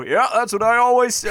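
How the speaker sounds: high, fast villager voice; chirpy little villager shouting up; Tedman's voice. A narrator's reading. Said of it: "You hear Tedman's voice from far below".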